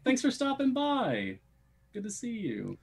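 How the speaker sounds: high-pitched put-on send-off; playful farewell. Host thanking viewer Lee, high playful farewell. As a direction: pitch it way up, sliding down at the end.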